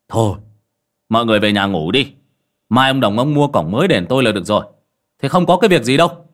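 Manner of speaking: brisk dismissal. Acted. Master sends crowd home.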